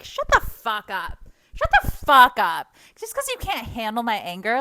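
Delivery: loud, forceful clapback; angry outburst. Acted. Answering people who can't handle her anger, furious. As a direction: yell it back at the critics. Snapping back at her critics.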